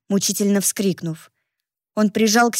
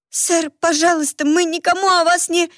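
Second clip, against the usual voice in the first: high, desperate, near sobbing; tearful pleading; 8 semitones higher than usual. Acted. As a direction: beg for your life in tears. Girl begs gunman.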